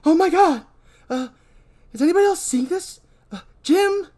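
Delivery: high, loud frightened cries; terrified in the dark tunnel; panicked Donna. Acted. Donna panicking when the power goes out. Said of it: "She screams".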